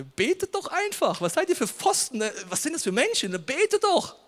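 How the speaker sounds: high, loud mock outrage; mocking scorn. Preacher mocking believers who tell the sick to pray, scornful. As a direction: ridicule them as clueless, overdone.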